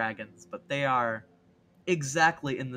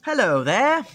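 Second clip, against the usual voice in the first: high, loud theatrical greeting; hammed-up movie-line recreation; Star Wars quote; 9 semitones higher, 12 dB louder than usual. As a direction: sing it out big and swooping. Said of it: "recreation of the Star Wars scene".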